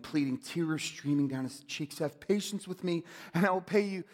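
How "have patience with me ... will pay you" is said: high, loud begging servant; desperate plea. Retold or imitated. Servant on his knees begging the king, higher and louder in desperation. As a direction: beg for mercy with everything. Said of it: "it just drips with desperation".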